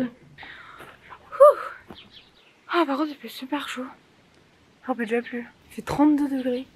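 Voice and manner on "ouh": sudden high yelp; startled yelp. Real, not acted. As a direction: yelp as you almost trip. Nearly falls.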